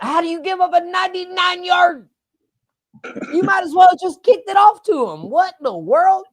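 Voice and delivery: high, loud cry of disbelief; incredulous outburst. Real, not acted. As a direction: shout it in real disbelief, pitch way up. Reacting to a ninety-nine-yard play.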